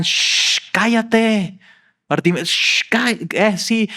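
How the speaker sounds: high, mock-stern shushing; hushing crowd. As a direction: shush him like an annoyed crowd. Crowd hushing the blind man.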